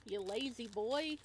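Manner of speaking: ordinary talk, nothing marked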